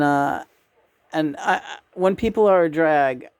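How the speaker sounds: usual speaking voice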